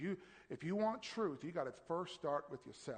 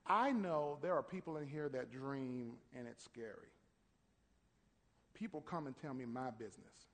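ordinary talk, nothing marked

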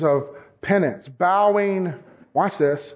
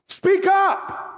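preaching shout; 13 semitones higher than usual. Acted. Preacher's sudden exhortation.

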